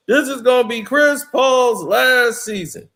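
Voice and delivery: high, loud, slow proclamation; announcement voice. Retold or imitated. Quoting the public season announcement.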